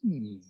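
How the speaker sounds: low, soft thinking "hmm"; pondering hum. Acted. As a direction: hum quietly as if deep in thought. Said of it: "And so he thought".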